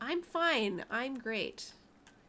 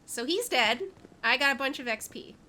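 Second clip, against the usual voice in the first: normal talking voice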